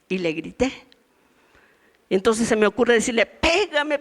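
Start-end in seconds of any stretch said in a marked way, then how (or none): 3.3-4.0 higher, challenging mock shout; defiant dare. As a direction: dare him to hit you. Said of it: "ya me enojé". (desafiante, más agudo)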